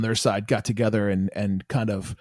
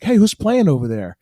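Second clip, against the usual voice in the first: higher, louder mock call across a street; imitated yell; 5 semitones higher, 5 dB louder than usual. Girl in line calls across to the other club, mock yell. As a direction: call out like a girl yelling over. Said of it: "she yelled across the street".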